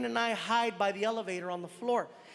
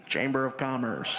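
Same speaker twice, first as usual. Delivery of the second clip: much lower, deadpan voice; fake official voice; 10 semitones lower than usual. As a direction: put on a low stranger's voice. Disguised voice at door.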